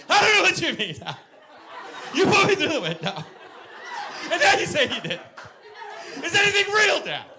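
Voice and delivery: high, loud mock-hysterical child; son losing his mind. Son to dad who denies it, hysterical high yelling. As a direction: imitate a boy in frantic meltdown. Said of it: "My son would have lost his mind".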